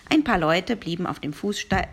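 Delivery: ordinary delivery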